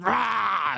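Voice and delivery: high, wild mock roar; hammed-up deranged yell; crazy roar. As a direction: burst out with a raving "rah". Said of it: "like a crazy homeless guy".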